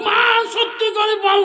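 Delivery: impassioned begging. Acted. Son begs mother for truth, high impassioned cry. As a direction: beg at the top of the preaching voice.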